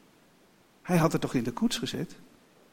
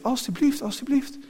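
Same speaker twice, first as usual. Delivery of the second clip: put-on pleading child; 8 semitones higher than usual. A narrator's reading. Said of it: "Emily zei nog steeds".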